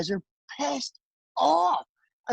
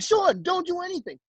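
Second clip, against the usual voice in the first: retold pleading; 11 semitones higher than usual. Begging Sean not to fight.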